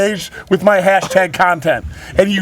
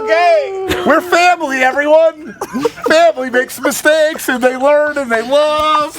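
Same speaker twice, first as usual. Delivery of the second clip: sappy sitcom moral; 7 semitones higher, slower than usual. Pretend. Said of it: "Dumbest fucking sitcom pitch".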